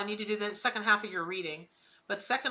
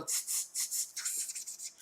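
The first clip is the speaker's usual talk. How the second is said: tsk-tsking; 10 dB quieter, slower than usual. Retold or imitated. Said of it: "walk up in front of your store and go".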